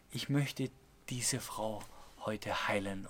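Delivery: low, hushed voice within; soft inner voice. Retold or imitated. Inner voice speaking to a young boy, soft and low. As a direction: let it sink very soft and low.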